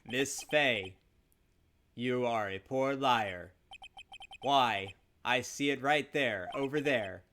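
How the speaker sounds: slow, deliberate villain; menacing murderer. Acted. Murderer demanding the papers from Miss Fay, slow menace. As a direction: take your time, savouring each demand.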